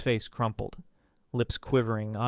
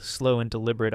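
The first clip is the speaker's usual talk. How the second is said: ordinary delivery, nothing marked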